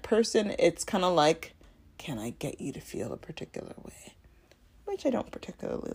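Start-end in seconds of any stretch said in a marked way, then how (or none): 2.0-4.1 low, hushed insinuating whisper; mock manipulative whisper; sly whisper. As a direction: whisper it like a manipulator's secret thought. Voicing manipulative intent.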